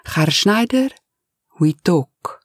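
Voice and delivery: put-on Spanish accent, pressing his boss; Spanish-accented butler. Eustacio's accented voice.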